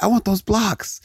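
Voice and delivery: put-on high, pleading kid voice; whining kid. Kid to parents about toys he wants, high pleading. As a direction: plead like a toy-crazed child. Child self begging parents.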